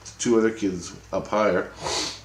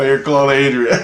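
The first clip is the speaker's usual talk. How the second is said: loud words through laughter; laughing; 6 semitones higher, 9 dB louder than usual. Real, not acted. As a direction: say it while cracking up. Laughing at alien's name.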